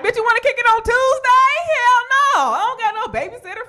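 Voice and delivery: high, loud sassy friend voice; mocking friend. Childless friends inviting her out on Tuesday, high sassy voice. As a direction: squeal the invite like a carefree friend.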